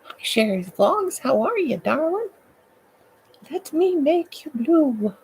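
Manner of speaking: high, swooping playful greeting; silly put-on voice. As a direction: greet a darling in a hammed-up high voice. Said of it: "why I'm talking like this".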